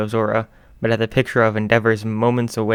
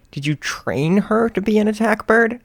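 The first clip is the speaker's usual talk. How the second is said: much higher surprised question; incredulous; 11 semitones higher, 4 dB louder than usual. Acted. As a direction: ask in disbelief. Host incredulous.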